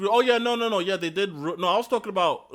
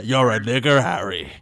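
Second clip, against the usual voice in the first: low put-on accent, "Harry" dropped much lower; 6 semitones lower than usual. Quoting the wizard line to friends in a low put-on accent. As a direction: deliver the movie line in a deep mock accent. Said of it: "I'm the only one who can actually do that accent".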